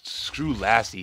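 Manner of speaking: loud, slow mock scorn; hammed-up mock scorn; mock dismissal. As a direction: toss her aside jokingly. Mock dismissal of old horse.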